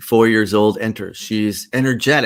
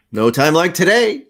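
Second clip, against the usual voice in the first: upbeat agreement; 9 semitones higher, 8 dB louder than usual. Acted. Upbeat agreement in acted scene.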